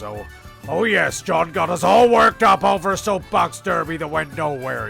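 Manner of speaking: ordinary delivery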